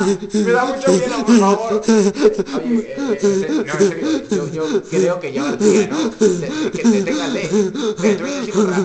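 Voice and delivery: loud, laughing plea; laughing pleading. Pretend. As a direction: beg through laughter.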